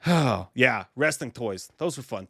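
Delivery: normal talking voice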